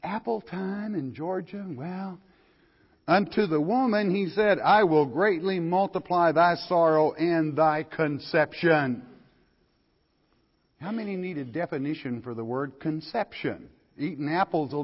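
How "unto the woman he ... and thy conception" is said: scripture reading. Acted. Reads scripture aloud, raised.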